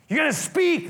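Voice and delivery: high, loud threat; threatening Herod. Acted. Herod threatening silent Jesus, high loud. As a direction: force him to talk. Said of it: "Herod threatening".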